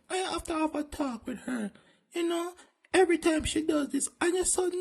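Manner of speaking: gentle high-pitched principal. Acted.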